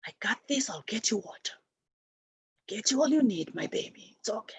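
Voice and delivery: hushed, half-whispered mother's comfort; tender reassurance. Retold or imitated. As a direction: softly soothe your baby, fading into a whisper. Voicing a mother comforting her baby.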